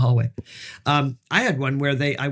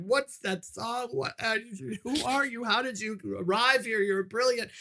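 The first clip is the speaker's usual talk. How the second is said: high, hushed mock amazement; imitated starstruck panel, high and soft; gushing admiration; 8 semitones higher, 6 dB quieter than usual. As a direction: gush softly, pitched way up, as if awestruck. Acts out the panel's gushing praise.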